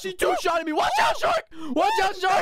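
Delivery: shrill panicked alert; panicked shout. Real, not acted. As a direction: yell a warning to your teammate. Panicked warning during Minecraft attack.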